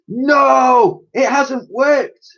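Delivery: high, loud anguished shout, slowing; raged. Acted. Professor rages that it hasn't worked. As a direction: rage at the failure. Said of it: "raged the professor".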